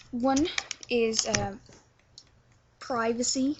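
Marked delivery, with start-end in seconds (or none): 2.8-3.6 "privacy" with long I, "PRY-vacy"; first vowel of "privacy" as in "pry"; American pronunciation. Shown as a demonstration. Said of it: "Americans say privacy".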